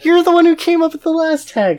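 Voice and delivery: high and loud through laughter; laughing. Real, not acted. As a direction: still cracking up. Still laughing.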